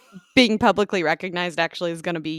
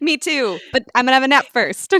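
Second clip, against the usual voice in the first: excited; 7 semitones higher, 9 dB louder than usual. Pretend. Joking hype-man bit continues.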